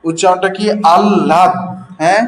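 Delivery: ordinary talk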